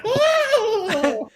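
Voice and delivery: high gasp. Retold or imitated. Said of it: "and she's like".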